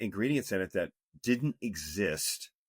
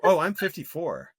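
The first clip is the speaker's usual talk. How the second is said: bright, raised "Oh", amused at being taken for younger; surprised correction; 9 semitones higher, 8 dB louder than usual. Real, not acted. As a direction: correct her with a surprised lift. Corrects host on his age.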